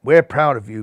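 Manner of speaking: put-on old Hoban praising Rajban, beaming. A narrator's reading. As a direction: speak like a proud old mentor. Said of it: "beamed old Hoban".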